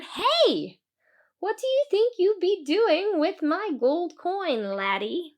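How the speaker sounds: high, sharp Irish-tinged challenge; leprechaun voice. Acted. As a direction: catch the thief in the act. Leprechaun catches coin thief.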